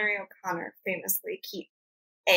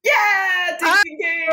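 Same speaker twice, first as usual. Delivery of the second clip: excited cheer; 9 semitones higher, 9 dB louder, slower than usual. Pretend. Game show correct answer cheer.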